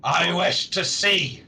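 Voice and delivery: insistent orc. Acted. Orc demands to see the creatures, slow and emphatic. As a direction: stretch each word as an emphatic orc.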